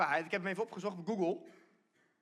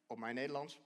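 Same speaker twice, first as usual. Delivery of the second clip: ordinary talk, nothing marked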